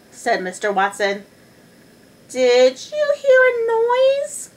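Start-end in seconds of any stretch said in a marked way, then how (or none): 2.3-4.5 high, slow questioning wife; wife's voice. A narrator's reading. Wife asking husband about a noise, high voice.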